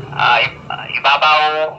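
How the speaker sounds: usual speaking voice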